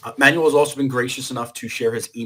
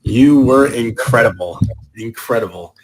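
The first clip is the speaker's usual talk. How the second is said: enthusiastic praise; 12 dB louder, slower than usual. Real, not acted. Host praising the presenter.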